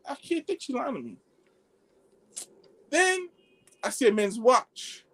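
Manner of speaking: ordinary delivery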